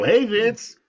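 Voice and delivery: high, loud put-on hello; hammed-up bright hail; mock cheery greeting. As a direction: act out an over-eager greeting to the boss. Acted greeting, asked about his smile.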